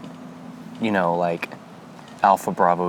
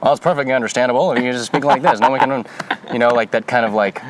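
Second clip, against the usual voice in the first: brisk, louder mid-Atlantic radio announcer; mid-Atlantic accent; 2 semitones higher, 4 dB louder than usual. Pretend. Showing cohost the mid-Atlantic newsreader accent, brisk radio announcer style. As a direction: play a forties mid-Atlantic broadcaster signing on. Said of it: "read it in a mid-Atlantic accent".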